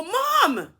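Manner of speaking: high, loud call to snap mom out; exasperated shout. Retold or imitated. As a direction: yell it sharply at a confused mother. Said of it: "it kinda snapped me out".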